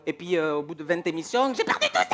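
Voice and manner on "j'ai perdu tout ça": high-pitched comic wail; exaggerated frantic yelp; mock anguished outburst. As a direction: cry out as if everything is lost. Mimicking contestant after twenty episodes.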